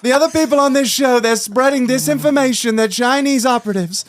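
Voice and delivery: tongue-in-cheek denial; mock conspiracy. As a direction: pretend the others are spreading lies. Jokingly denying eighty percent offer.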